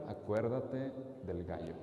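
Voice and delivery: hushed and low, with a stifled laugh; sly sister whispering a reminder; whispered threat. A narrator's reading. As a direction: breathe it close to his ear. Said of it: "se lo susurró".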